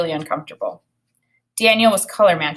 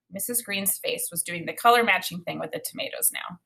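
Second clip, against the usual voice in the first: ordinary delivery, nothing marked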